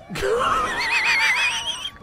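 high squealing laughter; shrieking laugh. Real, not acted. Streamer laughing at flying car, shrieking laugh. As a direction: howl with laughter.

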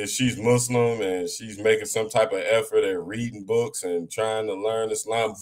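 usual speaking voice

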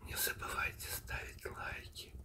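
ordinary delivery

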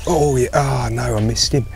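ordinary talk, nothing marked

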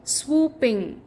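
ordinary delivery

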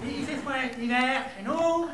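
broad comic Québécois French; hammed-up Québécois accent. Shown as a demonstration. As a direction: twist the vowels in a Québécois accent. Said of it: "c'est l'accent québécois".

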